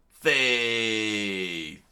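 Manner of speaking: a hint of a heroic shout; mild mock yell. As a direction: cry out in defiance. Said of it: "And Luffy screamed out".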